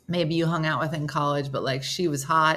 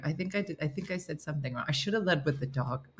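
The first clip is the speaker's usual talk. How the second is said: sheepish backtracking; 3 semitones lower, 8 dB quieter, faster than usual. Acted. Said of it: "Mateo's like".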